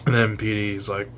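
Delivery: normal talking voice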